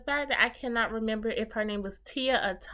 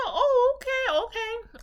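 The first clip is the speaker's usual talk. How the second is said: much higher, louder startled okays; shocked; 5 dB louder, slower than usual. Retold or imitated. Host reacts in shock as T reveals three kids. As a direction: gasp and stall in disbelief.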